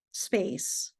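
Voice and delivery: ordinary delivery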